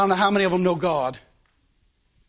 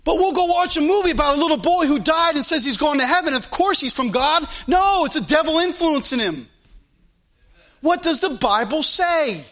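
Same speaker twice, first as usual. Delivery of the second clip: higher-pitched, insistent pulpit voice; fervent preaching; 6 semitones higher than usual. Real, not acted. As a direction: press the challenge home like a preacher.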